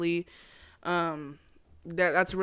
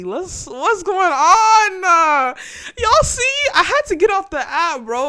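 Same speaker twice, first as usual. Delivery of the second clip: mock wail; 10 semitones higher, 9 dB louder than usual. Real, not acted. Mock wail at the Freemason post.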